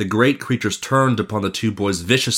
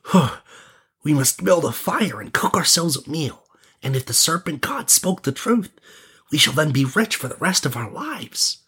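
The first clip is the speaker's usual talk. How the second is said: higher, lightly winded prince; a touch out of breath, pitched up; breathing hard; 6 semitones higher than usual. Acted. Prince to his friend after the fight, lightly breathing hard. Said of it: "leaning on his staff and breathing hard".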